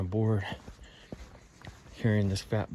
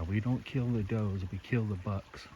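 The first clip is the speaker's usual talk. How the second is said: normal talking voice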